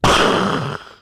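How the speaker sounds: loud breathy gunshot burst; mock musket shot heard from behind; imitated gunshot. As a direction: blast out a gunshot noise. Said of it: "All I hear is".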